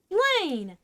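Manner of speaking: high-pitched, hurt cry of his name; indignant exclamation. A narrator's reading. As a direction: call him out, offended, pitch jumping up. Offended girl protests remark on weight.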